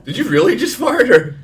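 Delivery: high, loud, breaking with laughter; laughing hard. Real, not acted. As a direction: ask through tears of laughter. Laughing fit over a noise.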